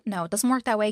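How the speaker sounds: ordinary delivery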